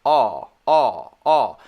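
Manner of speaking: long open aw sound, tongue flat; drawn-out aw. Shown as a demonstration. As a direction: hold the aw open and loud. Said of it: "your tongue should be very flat".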